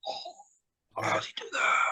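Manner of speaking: put-on hushed, breathy childlike wonder; awestruck whisper. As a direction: whisper it like a kid amazed at the screen. Retold childhood awe at Superman.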